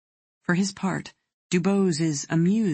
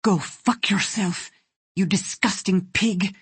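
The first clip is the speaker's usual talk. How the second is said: loathing; 4 semitones higher, 3 dB louder than usual. A narrator's reading. Shackled girl curses her captor Jurgen, full of loathing. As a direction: spit each word out with contempt. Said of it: "the loathing in her voice".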